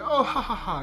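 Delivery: high, loud taunting laugh; mocking laugh. Killer laughing off the dagger scratch, mock villain laugh. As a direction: cackle at the scratch, villain style. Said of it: "he's like".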